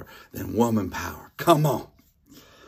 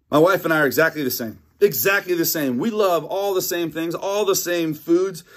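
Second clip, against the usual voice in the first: mock-earnest, higher and quick; sarcastic; 7 semitones higher than usual. Pastor jokes about his marriage, sarcastic mock sincerity. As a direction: oversell it so nobody believes you. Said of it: "no, not at all".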